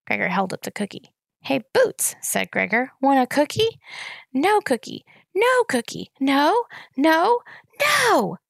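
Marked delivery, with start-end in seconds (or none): 1.4-3.8 coaxing tease. A narrator's reading. Teasing offer to toddler. 4.3-8.4 high, loud wailing no; a toddler past soothing; toddler tantrum. A narrator's reading.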